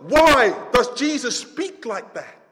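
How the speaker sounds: high, loud, exasperated complaining; mock indignant question. Pastor voicing a doubter's loud complaint. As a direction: shout out the doubter's protest. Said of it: "And you think to yourself".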